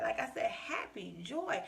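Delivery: ordinary talk, nothing marked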